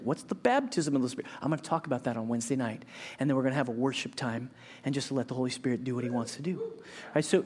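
ordinary delivery

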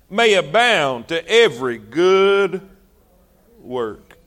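slow, measured scripture cadence; solemn preacher reading scripture aloud. Acted. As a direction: intone the verse like a preacher. Reads scripture slowly, intoning.

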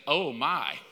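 a hint of the wife admiring the actor; wife sighing. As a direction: murmur it like a smitten wife. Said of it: "This is exactly what she says".

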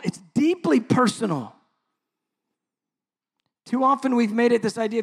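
fervent preacher driving the point home; impassioned preaching. Real, not acted. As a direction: preach it hard, punching key words. Preaches at full force.

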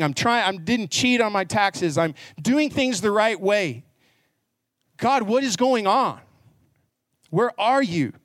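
high, pleading list of grievances; exasperated complaint. Acted. Elijah protesting to God that he did things right, exasperated.

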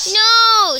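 a loud, high no yelled back; screamed no. Acted. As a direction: yell it down from upstairs. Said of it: "screamed Billy".

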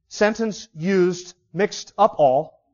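very slow, word by disjointed word; mixed-up word order. Shown as a demonstration. Preacher demonstrates confusing word order to listeners, slow and disjointed. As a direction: lay out each jumbled word separately. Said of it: "if I spoke like that continually".

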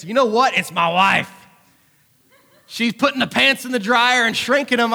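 usual speaking voice

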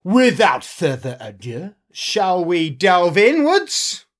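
slow, drawn-out announcer build-up rising high; grand show opener. Acted. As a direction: usher listeners in with theatrical relish. Host grandly opens the show.

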